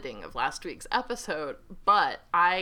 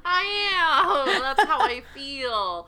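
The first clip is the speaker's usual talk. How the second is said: playful spooky voice; 5 semitones higher, 9 dB louder, slower than usual. Pretend. Playing Nosferatu, loud.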